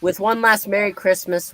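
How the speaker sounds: normal talking voice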